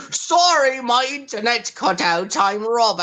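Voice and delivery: lightly voiced British Robbie; British accent. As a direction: play Robbie with a British lilt. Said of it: "I'm British now".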